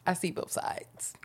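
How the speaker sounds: near-whispered, hesitant concession; whispered diplomatic reply; hushed admission. Real, not acted. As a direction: say it very softly, almost voiceless. Reluctant, diplomatic reply in friendly argument.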